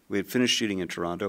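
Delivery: usual speaking voice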